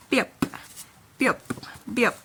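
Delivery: Biop said alone as one quick word; letter-name demo. Shown as a demonstration. Teacher naming the B consonant for learners, letter-name demo. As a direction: repeat Biop crisply, with long gaps. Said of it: "it is called".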